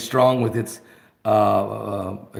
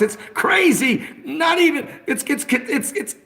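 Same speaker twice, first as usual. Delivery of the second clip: mock frantic squeal; 13 semitones higher than usual. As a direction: squeal it out like a gleeful tattletale. Mocking Bernie getting angry.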